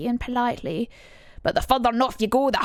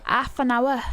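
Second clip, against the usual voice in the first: imitated Yorkshire accent; 2 semitones higher than usual. Streamer echoing the little girl's clip, imitated Yorkshire accent. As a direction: parrot the clip in broad Yorkshire. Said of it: "Listen to this accent".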